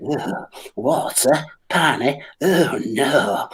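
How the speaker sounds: slow, strange panicky voice; panicking bladder. Retold or imitated. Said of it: "my bladder was like".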